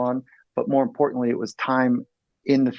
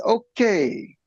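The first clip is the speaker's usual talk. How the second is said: high, drawn-out okay; halting interjection; 10 semitones higher than usual. Retold or imitated. Therapist stopping the fighting couple in therapy, drawn-out interjection. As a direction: stretch it out high to stop a squabble.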